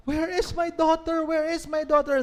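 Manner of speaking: high, loud panicked searching; frantic parent. Acted. Parents realize their daughter is still inside, frantic cry. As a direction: cry out in fear for the missing child.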